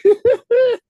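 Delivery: very high, slow squeal; falsetto squeal. Pretend.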